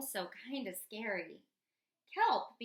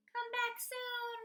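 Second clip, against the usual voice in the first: slow, much higher, lilting goodbye; sweet farewell; 12 semitones higher, slower than usual. Acted. Unicorns waving Kelp off, a high lilting goodbye. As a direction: sing it out as a fond farewell.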